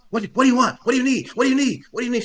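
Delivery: high, loud snapping; impersonating a customer snapping at the guard. Retold or imitated. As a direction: bark it like a rude customer. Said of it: "She's snapping".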